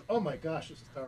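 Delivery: strained and hushed from coughing; choking on water. Real, not acted. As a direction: barely get words out after choking. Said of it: "I shouldn't take a drink of water".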